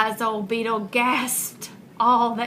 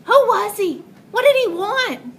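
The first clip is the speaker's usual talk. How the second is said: much higher, puzzled cries; animals crying out; 10 semitones higher, 6 dB louder than usual. Acted. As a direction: animals bewildered and calling out. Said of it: "the animals cried".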